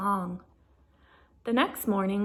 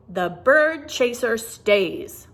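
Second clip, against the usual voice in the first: firm; 7 dB louder, slower than usual. Acted. Laverne insists firmly.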